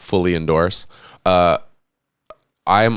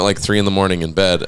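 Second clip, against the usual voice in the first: ordinary talk, nothing marked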